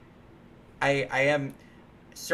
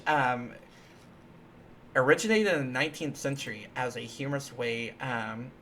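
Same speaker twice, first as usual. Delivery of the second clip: usual speaking voice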